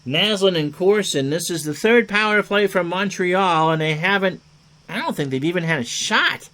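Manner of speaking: ordinary talk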